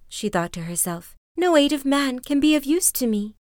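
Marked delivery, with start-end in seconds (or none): 1.4-3.3 put-on higher, hopeless young woman; Lily, higher despairing. A narrator's reading. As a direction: sigh out her despair, high.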